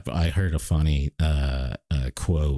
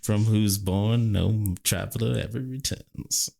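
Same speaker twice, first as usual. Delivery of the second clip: higher, slow quoting voice; theatrical recitation; 7 semitones higher than usual. Acted. Capping the undiscovered country remark, theatrical recitation. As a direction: recite it slowly, pitched up. Quoting a famous line after laughing.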